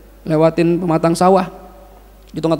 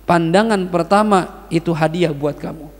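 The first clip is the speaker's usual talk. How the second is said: ordinary talk, nothing marked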